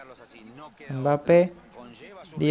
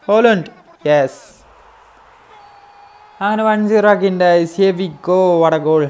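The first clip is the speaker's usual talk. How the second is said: goal celebration; 2 semitones higher, 6 dB louder than usual. Real, not acted. Gamer celebrating his first goal to viewers, loud cheer. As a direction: cheer it like you just scored.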